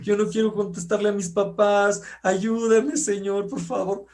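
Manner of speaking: imitating his young daughter praying through tears; little girl's tearful prayer. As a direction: voice the small daughter begging the Lord. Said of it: "le escuchábamos llorando".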